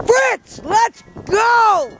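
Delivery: high, loud call across the yard. Real, not acted. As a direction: shout the dog's name to bring him in. Calling dogs across snowy yard.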